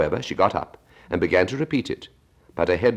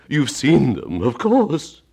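Mock Turtle voice; 9 semitones higher, 7 dB louder, much slower than usual.

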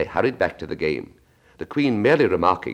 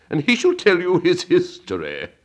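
much higher, louder imperious Queen; 12 semitones higher, 4 dB louder than usual. Acted. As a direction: demand it of Alice. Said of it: "said the Queen".

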